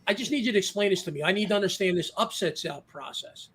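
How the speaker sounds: ordinary talk, nothing marked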